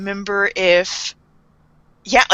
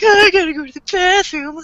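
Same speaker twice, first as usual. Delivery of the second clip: put-on silly drunk voice; 9 semitones higher than usual. Matsuda acting drunk and adorable.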